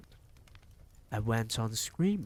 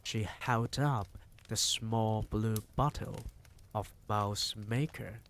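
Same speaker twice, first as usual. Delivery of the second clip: ordinary delivery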